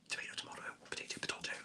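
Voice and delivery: very soft, near-whispered aside; muttered aside. Real, not acted. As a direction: toss it off under your breath. Tossed-off aside on pronunciation.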